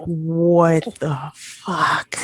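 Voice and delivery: whispered, very slow, disbelieving; slow furious whisper. Retold or imitated. Stunned guest whispering at the man's nerve.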